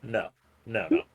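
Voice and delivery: normal talking voice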